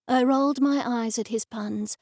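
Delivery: plain reading voice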